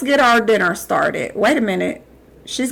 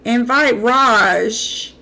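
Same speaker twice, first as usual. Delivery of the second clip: slow accusing question; much slower than usual. Real, not acted.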